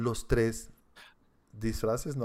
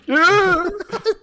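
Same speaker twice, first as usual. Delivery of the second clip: sudden high burst of laughter; big, high, loud laugh; 13 dB louder than usual. Real, not acted.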